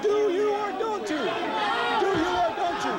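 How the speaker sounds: high-pitched, drawn-out challenge; yelling. Real, not acted. Guest pressing the host for an answer, yelling. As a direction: yell the question, pressing for an answer. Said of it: "Don't you ever yell at me".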